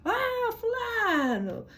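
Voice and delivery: a high, drawn-out call across the room. Retold or imitated. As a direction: stretch the name out high and slow like yelling for someone. Loud family calling someone.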